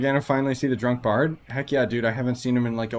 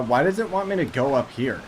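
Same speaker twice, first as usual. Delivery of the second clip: normal talking voice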